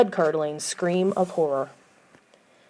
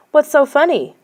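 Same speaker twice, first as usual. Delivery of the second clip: suspicious; 8 semitones higher, 6 dB louder than usual. Acted. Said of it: "Lauren asked suspiciously".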